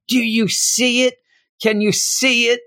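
high, anxious questioning; lightly voiced panicked heroine; mock frantic Psylocke. Psylocke asking Laura about the noise, high and anxious.

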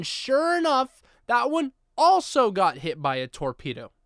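high, loud "sure enough" in disbelief; exasperated. Acted. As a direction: throw up your hands at it.

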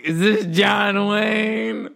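drawn-out, put-on prank-call shout; prank caller. Re-enacting his own prank call to friends, slow silly caller voice. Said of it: "I was like".

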